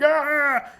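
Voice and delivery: high, loud scream for dramatic effect; re-enacted scream. Driver inside the truck hearing brakes slam, screaming. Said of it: "I'm inside the truck screaming".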